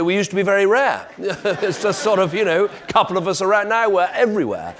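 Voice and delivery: high, loud laughing delivery. Real, not acted. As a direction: laugh through the joke. Joke about many ex-Prime Ministers.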